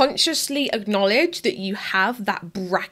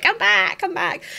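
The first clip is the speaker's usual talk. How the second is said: playful mock gremlin call; half-hearted gremlin impression, calling someone back; attempted gremlin voice; 6 semitones higher than usual. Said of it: "I can't do a gremlin voice".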